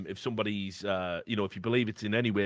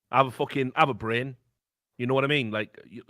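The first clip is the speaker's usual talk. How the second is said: ordinary talk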